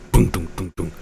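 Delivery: low soft drum booms; low quiet timpani booms. Shown as a demonstration. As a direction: thump it out like timpanis. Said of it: "Like timpanis".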